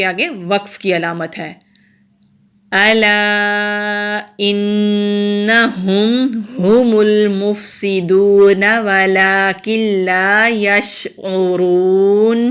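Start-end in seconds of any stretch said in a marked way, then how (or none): 2.7-12.4 slow, melodic tajweed chant; Quran recitation. Shown as a demonstration. Teacher reciting the verse for students, slow melodic tajweed. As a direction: draw the verse out in measured recitation.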